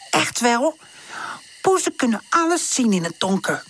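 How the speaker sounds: lightly voiced cat, a touch indignant; cat defending her night sight, lightly indignant; offended cat.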